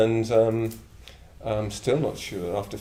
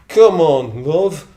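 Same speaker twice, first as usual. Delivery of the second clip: loud, high, slow wail half-laughing; wailed plea; 7 semitones higher, 11 dB louder, slower than usual. Retold or imitated. Stranded northerner pleading with grim barrier guards, loud slow wail. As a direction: wail it from the back of the crowd. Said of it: "sort of wailed out".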